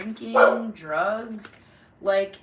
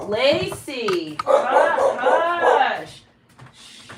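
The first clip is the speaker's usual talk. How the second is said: sharp, louder hush that breaks off; hushing the dog; 3 semitones higher, 5 dB louder, much slower than usual. Real, not acted. To her barking dog Lacey, a sharp raised hush. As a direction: cut the dog off mid-bark.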